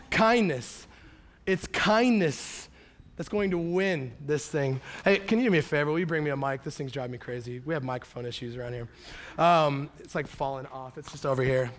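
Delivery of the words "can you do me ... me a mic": normal talking voice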